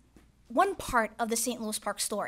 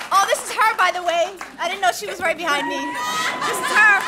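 higher, louder laughing surprise; laughing happy surprise; surprised delight; 7 semitones higher, 11 dB louder, faster than usual. Real, not acted. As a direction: caught off guard by mom behind her. Mother suddenly right behind her.

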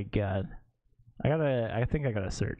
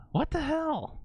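drawn-out disbelief; slower than usual. Real, not acted. Reacts in disbelief.

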